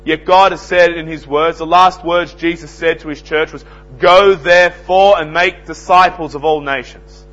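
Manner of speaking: loud, high preaching, slowing on key words; fiery sermon delivery; full-force preaching. Acted. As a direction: thunder it out from the pulpit. Preacher quotes the great commission.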